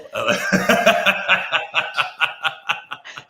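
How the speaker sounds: long, high, loud peal of laughter. Real, not acted. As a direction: crack up at your own joke. Laughing at own joke.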